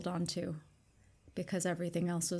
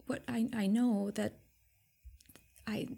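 hesitant whisper; 3 semitones higher than usual. Acted. Nahara's player whispers hesitantly.

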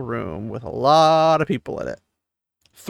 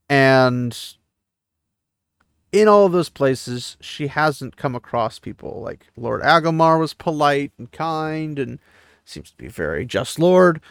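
ordinary talk, nothing marked

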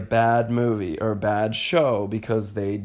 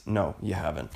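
ordinary talk

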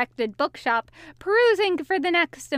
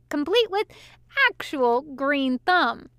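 ordinary delivery, nothing marked

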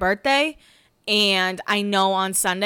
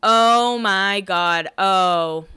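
drawn-out, imitated "oh my God"; retold shocked reaction; 4 dB louder, much slower than usual. Podcaster mimics Alex M reading Cami's texts, drawn-out shock. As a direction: stretch it out slowly, acting out her reaction. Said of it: "hear her start going like".

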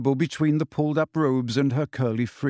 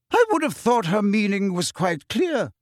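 stern, clipped rebuke; harsh; 7 semitones higher than usual. Acted. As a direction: cut her down sharply. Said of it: "said Professor McGonagall harshly".

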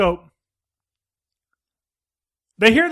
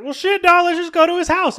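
high casual dude voice; 10 semitones higher than usual. Retold or imitated. Friend two suggests going to the house, high and casual. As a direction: pitch it up high, a laid-back buddy. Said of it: "friend number two's like".

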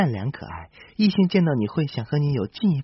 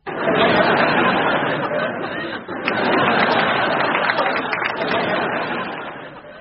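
long giggling laugh; high breathy laugh. Real, not acted. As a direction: burst out laughing, airy and high. Laughs at wrong test.

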